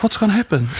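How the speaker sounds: high-pitched imitation of a questioner; retold challenging question. Imitating brother questioning him about the risk, high-pitched challenge. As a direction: pitch it well up, lightly mocking the question.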